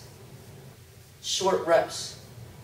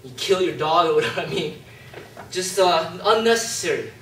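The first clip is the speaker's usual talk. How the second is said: usual speaking voice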